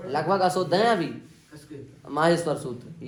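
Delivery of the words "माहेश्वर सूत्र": ordinary talk, nothing marked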